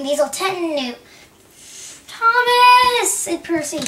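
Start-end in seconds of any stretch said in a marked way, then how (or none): 2.1-3.1 high, loud drawn-out cry; desperate call. Acted. Percy calling to Thomas for mercy, desperate call. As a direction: wail the name out long.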